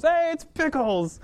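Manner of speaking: high, slow, loud call to a dog; excited greeting. Retold or imitated. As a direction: call the dog across the trail, thrilled. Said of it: "and Steven, of course".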